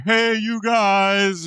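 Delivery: slow, drawn-out monster greeting; dopey slow monster voice; Frankenstein voice. Retold or imitated. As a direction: drag it out like a lumbering monster. Mimicking Frankenstein's voice.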